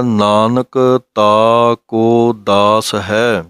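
slow line with a clear stop after ਹੈ; pause after ਹੈ. Shown as a demonstration. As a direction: chant it slowly and rest on ਹੈ. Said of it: "ਇੱਥੇ ਵਿਸਰਾਮ ਲੱਗੇਗਾ".

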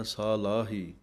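ਸਾਲਾਹੀ with a full long ਸਾ and long ਲਾ; ਸਾਲਾਹੀ said deliberately with a falling close; careful pronunciation. Shown as a demonstration. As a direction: give both ਕੰਨਾ vowels of ਸਾਲਾਹੀ their full length. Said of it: "ਸੱਸੇ ਕੰਨਾ, ਲੱਲੇ ਕੰਨਾ ਬੋਲਣਾ ਹੈ".

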